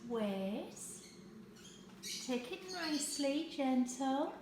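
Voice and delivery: high coaxing praise for the dog; talking to a dog. Real, not acted. Giving the dog a treat.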